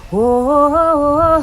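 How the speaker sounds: loud high "whoa"; excited whoa. Real, not acted. Excited exclamation at the end.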